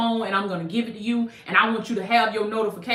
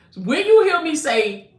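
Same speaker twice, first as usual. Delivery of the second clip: ordinary talk, nothing marked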